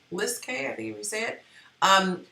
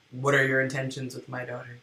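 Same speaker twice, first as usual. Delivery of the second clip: lower, quicker stern dad voice; gruff overprotective dad; 7 semitones lower, 4 dB quieter, faster than usual. Retold or imitated. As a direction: grill him like a protective father. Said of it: "That's what my dad said".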